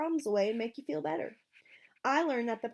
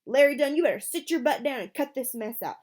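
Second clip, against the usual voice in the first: loud, sharp order shouted down the bus; furious bus driver ordering Larry to sit; angry yell; 4 semitones higher, 8 dB louder than usual. A narrator's reading. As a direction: bark it at the bully.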